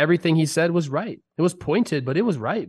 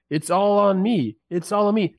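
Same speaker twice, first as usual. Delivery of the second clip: mock-sincere, pitched up and slowed; mocking quote; 7 semitones higher than usual. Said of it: "chastise people saying".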